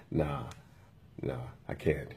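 low, soft refusal through a little smile; smirking refusal. Retold or imitated. Imitating the employee refusing the restroom, with a little smile. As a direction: imitate someone smugly turning you down, low and quiet. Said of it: "And took a little smile".

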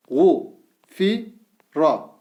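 Gu said with a thick back U, each syllable drawn out; syllable drill with thick vowel. Shown as a demonstration. Teacher reads the thick-vowel syllables to students, slowly. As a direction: read out each syllable apart. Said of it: "U sesi veriyoruz".

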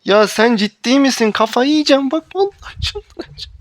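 loud yell climbing to a shrill shriek; building rage shout. Real, not acted. Gamer losing it at teammate, yell rising to a shriek. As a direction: lose it completely by the end.

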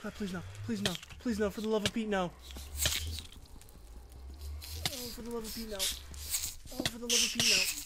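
whispered frantic no's; begging under the breath; hushed pleading. Real, not acted. Quiet desperate pleading in game.

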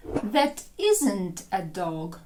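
slow, "isn't" hit hardest in the line; stress on isn't. Shown as a demonstration. Teacher to students, slow with "isn't" stressed. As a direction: lean on "isn't" and take it slowly. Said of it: "Наголос падає на isn't".

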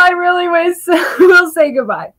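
high, crying through a laugh; choked-up goodbye with a kiss; tearful goodbye. Real, not acted. Saying goodbye to her stream viewers, teary and high. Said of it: "now that the tears are coming down".

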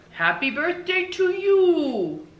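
high, loud, drawn-out birthday cheer; gleeful husband, stretched out and high; Mister Tickum, exuberant. Acted. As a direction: belt out a birthday greeting with big excitement.

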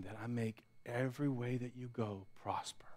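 hushed whispered promise. Real, not acted. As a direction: drop to a bare whisper. Preacher drops to intimate whisper.

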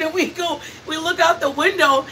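normal talking voice

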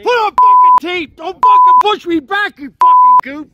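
furious high shout; angry yelling. Real, not acted. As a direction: scream it at the cop. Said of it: "You keep yelling".

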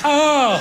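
high, drawn-out "oh"; high mock moan on impact; comic impact cry. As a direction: cry out as you crash into the airbag. Driver hits the airbag.

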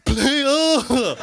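high, loud club lad yell; player shout. Retold or imitated. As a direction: bellow it across the club. Club players answering back.

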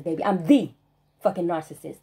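usual speaking voice

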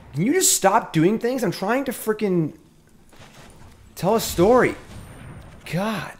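fed up with the game cutting in on his story; exasperated. Real, not acted.

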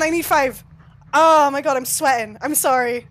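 ordinary talk

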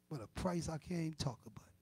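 drops to a breathless whisper; barely voiced awe; hushed whisper. Real, not acted. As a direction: whisper it, too moved to say it aloud. Overcome mid-sentence after "whew.

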